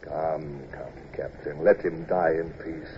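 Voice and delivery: low, soft and slow; gentle urging. Acted. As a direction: quietly coax him away from the dying man. Commodore gently draws captain away.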